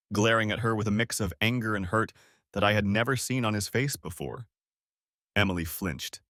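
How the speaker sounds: plain reading voice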